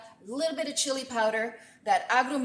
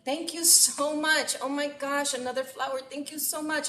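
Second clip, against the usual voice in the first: higher, delighted thank-yous to viewers; gushing thanks; 6 semitones higher than usual. Real, not acted. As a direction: beam through the thanks. Thanking live viewers for gifts.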